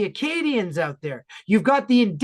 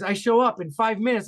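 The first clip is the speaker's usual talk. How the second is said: normal talking voice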